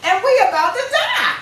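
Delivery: high, loud, panicky shouting with a laugh breaking in; panicked disciples. Retold or imitated. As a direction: yell it like sailors sure they are about to drown. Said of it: "the disciples are scared as a motherfucker".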